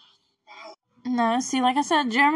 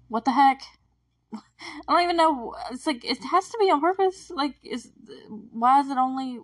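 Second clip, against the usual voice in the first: baffled exclamation; 5 semitones higher, 4 dB louder than usual. Real, not acted. Baffled by the scene.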